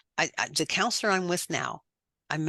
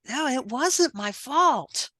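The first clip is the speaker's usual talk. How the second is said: dawning conviction; 7 semitones higher, 3 dB louder, much slower than usual. Retold or imitated. Realizing truth, retold self-talk.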